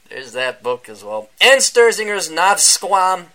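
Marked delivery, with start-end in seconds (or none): none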